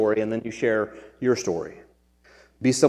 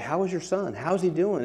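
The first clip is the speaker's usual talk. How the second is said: concerned coach; 5 semitones higher, 6 dB quieter than usual. Retold or imitated. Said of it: "was like".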